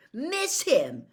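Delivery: indignant retort. Acted. Harry angrily denying.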